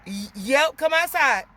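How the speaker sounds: loud call to the dog, up high; shouting for the dog to come outside. Real, not acted. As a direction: call him out the door, loud.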